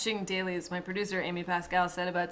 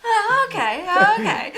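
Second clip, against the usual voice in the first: much higher and louder, laughing through it; high laughing mock surprise; 13 semitones higher, 13 dB louder than usual. Director replaying her own reaction to the scarf news, laughing and high. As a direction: laugh out a delighted mock "oh, okay".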